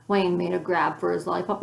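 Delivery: plain reading voice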